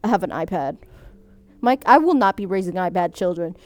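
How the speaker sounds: ordinary talk, nothing marked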